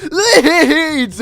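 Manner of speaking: high, loud yell broken by laughter; shouting. Retold or imitated. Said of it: "we're just shouting Leeds".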